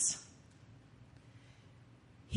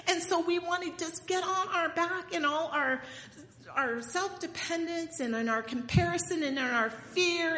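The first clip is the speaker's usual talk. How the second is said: urgent sermon push about forgetting; rising preaching; 5 semitones higher, 4 dB louder than usual. Acted. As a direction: preach it, louder and higher as it builds.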